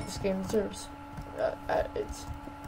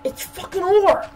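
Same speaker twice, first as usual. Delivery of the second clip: much higher, loud disbelieving cry; exasperated outburst; 11 semitones higher, 6 dB louder than usual. Real, not acted. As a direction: explode at the screen. Outburst at finding ore.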